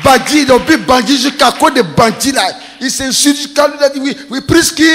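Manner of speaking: high shouting across the road; mock yell of the quarrel; shouted insults. Brothers trading insults, mock shouting.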